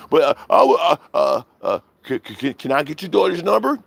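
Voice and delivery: high stuttering caller voice; stammering mock caller. Streamer mocking the nervous troll stammering.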